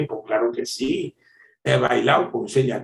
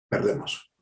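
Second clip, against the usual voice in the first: ordinary talk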